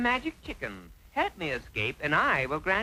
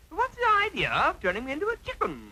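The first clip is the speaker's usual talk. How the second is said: higher, insistent question; exasperated again; 8 semitones higher than usual. Acted. Lad insisting the chicken answer, higher and irritated. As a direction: press the point, clearly irritated. Asks a third time.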